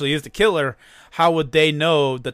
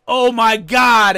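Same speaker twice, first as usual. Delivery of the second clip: mock horror; 6 semitones higher, 8 dB louder, slower than usual. Eddie finds the murdered kids.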